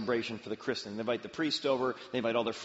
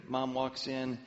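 ordinary talk, nothing marked

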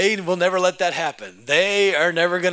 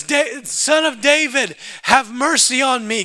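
high, raised-voice plea; insistent retold shout, pitched up; louder shout; 5 semitones higher, 3 dB louder than usual. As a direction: cry out higher to be heard over the crowd. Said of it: "he begins to shout louder".

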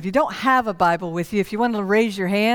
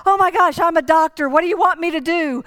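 alarmed doctor; 6 semitones higher than usual. Retold or imitated. Doctor kneels over the crashed old man, high and panicked. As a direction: blurt it out in a panic.

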